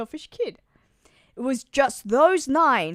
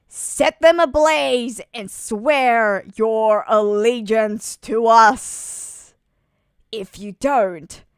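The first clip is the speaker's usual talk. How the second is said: menacing villain; 6 semitones lower than usual. Acted. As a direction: lay out the demands like a tyrant. Villain delivering threat to town.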